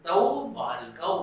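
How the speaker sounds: तौ बालकौ said slowly and clearly; slow Sanskrit demo. Shown as a demonstration. Teacher repeating the dual form for students. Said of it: "तो हम बोलेंगे बालकौ".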